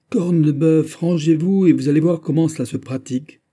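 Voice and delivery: loud, high commanding voice; booming foreman's order; powerful command. A narrator's reading. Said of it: "d'une voix puissante".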